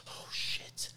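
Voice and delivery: breathless whisper, barely voiced; whispered in shock. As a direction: whisper it like you've seen a ghost. Sees friend he thought dead.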